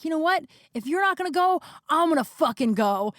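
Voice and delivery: imitated angry ultimatum, starting high; retold anger. Angry boyfriend threatening to leave, retold high at first. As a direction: mimic him storming off, high then dropping. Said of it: "Why are you so angry?".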